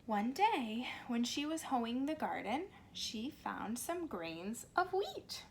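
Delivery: put-on slow, lively storybook reading for little kids; reading to children. A narrator's reading. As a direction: read it like story time on the carpet. Reads picture book to children.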